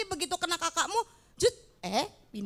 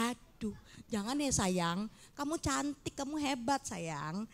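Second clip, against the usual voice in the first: reassuring; 4 semitones lower, 10 dB quieter, slower than usual. Real, not acted. Comforting a child in class.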